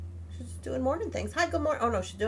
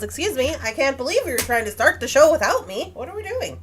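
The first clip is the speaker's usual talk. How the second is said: lightly voiced indignant cat, louder; mock outrage for the pet, a bit louder; voicing the cat; 3 semitones higher, 9 dB louder than usual. Speaking for her cat, who was left out of the show, lightly indignant. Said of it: "Mister Bunsen says".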